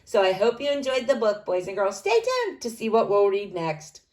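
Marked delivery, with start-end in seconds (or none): none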